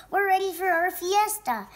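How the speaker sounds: high, loud, bubbling storybook voice; excited announcement. A narrator's reading. Rabbit hops before the fiesta, excited. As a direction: call out with eager excitement.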